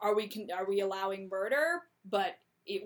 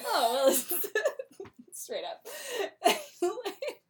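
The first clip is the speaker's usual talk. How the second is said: giggling through the words in a high voice; a high, amused giggle; laughing; 12 semitones higher, slower than usual. Retold or imitated. Portia's odd reply, laughing.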